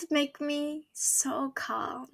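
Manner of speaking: slow, soft whisper. Real, not acted. Telling viewers how the old lady's words calmed her, slow whisper. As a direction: breathe it out slowly in a whisper. Recalling the comforting moment.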